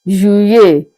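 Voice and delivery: Juillet as "zhu-YAY"; French pronunciation. Shown as a demonstration. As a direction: say Juillet with the double L as a Y. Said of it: "July in French is pronounced as".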